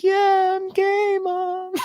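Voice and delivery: very high, squeaky admission; high-pitched embarrassed yes; sheepish confession. Retold or imitated. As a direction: squeak it out to mom. Confessing to mom in hospital.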